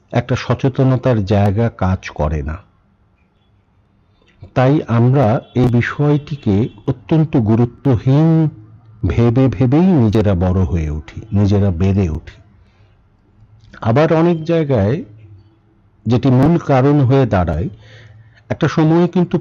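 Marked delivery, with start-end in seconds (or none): none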